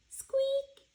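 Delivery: tiny high whispered squeak; squeaky mouse noise. Acted. Reading to kids, a tiny high squeak.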